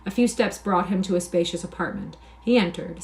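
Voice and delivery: ordinary delivery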